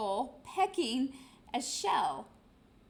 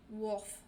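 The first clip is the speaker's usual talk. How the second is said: dog bark as a word; 3 semitones lower than usual. Acted. Dog barks.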